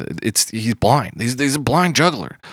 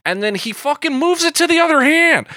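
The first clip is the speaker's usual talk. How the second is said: amazed outburst; 3 dB louder than usual. Real, not acted. Host marvels at the juggler's trick, amazed high outburst.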